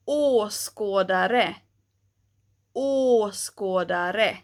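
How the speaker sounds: "åskådare" slow, each word said twice; word drill. Shown as a demonstration. Teacher drilling Swedish å words for learners. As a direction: repeat each å word clearly. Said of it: "we have words like".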